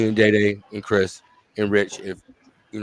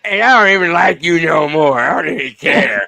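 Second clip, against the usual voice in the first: mock pouting fan; 6 semitones higher, 4 dB louder, slower than usual. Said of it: "She sound like a Michigan fan".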